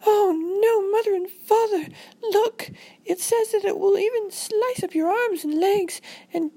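put-on very high-pitched panicked girl; hammed-up squeaky panic; freaking out. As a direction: wail it like a terrified child. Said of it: "so that really made Robin freak out".

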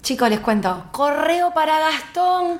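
slow, high, singsong teacher announcing the letter; mocking teacher. Teacher reads kid's letter aloud to preschool, slow singsong mock. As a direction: tease the whole class with it, drawn out.

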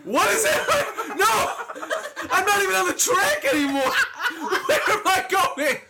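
high, laughing shrieks of disbelief. Real, not acted. His race car glitches and he shrieks with laughter.

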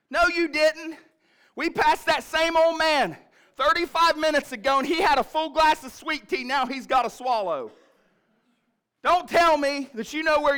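high, loud mock nagging; a wife laying into her stubborn husband; hammed-up mom insisting on directions; scolding mom. Mom scolding lost dad.

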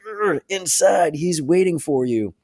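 lightly voiced scared henchman; cowed thug. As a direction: answer like a beaten-down goon. Beaten thug answers Batman.

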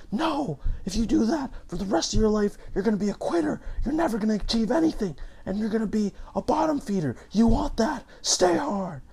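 high, loud, hammed-up tirade; screaming motivational dad. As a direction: scream it like a fanatical motivational speaker scolding a child. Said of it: "then he's just screaming at them".

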